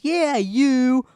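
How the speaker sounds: high, loud retold call; infomercial-like shout. Biker singles out the boy, high infomercial-like shout. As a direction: imitate an emphatic pitchman's "you". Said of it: "like an infomercial".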